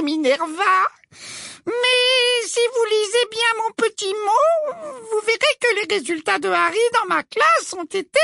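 very high, slow sugary voice; falsely sweet, gloating teacher; simpering. A narrator's reading.

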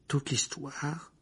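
slow, soft questioning echo; a soft, slow rising question; incredulous echo. Acted. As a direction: repeat it, stunned. Harry repeats incredulous.

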